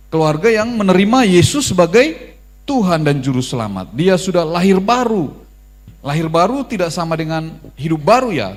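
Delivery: loud, emphatic sermon voice; forceful preaching. Acted. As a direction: drive the doctrine home to the congregation. (khotbah dengan suara keras dan tegas)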